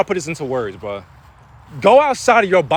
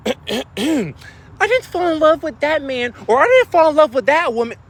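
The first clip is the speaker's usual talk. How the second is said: mock regretful partner; 8 semitones higher than usual. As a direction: lightly play someone regretting their choice. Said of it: "You're gonna say".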